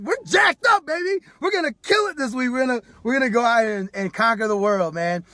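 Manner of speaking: high, loud pumped-up cheer; whooping, high and loud, pumped to go; hyped-up excitement. Retold or imitated. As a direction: mimic the crowd all fired up. Acting out hyped-up excitement again.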